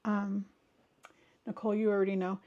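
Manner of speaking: normal talking voice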